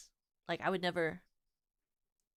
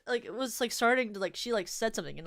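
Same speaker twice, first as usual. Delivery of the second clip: usual speaking voice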